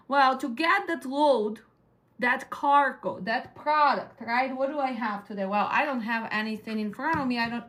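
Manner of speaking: ordinary talk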